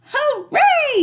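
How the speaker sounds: very high, loud cheer; audience yelling. A narrator's reading. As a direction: cheer like a thrilled crowd. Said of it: "yelled the audience".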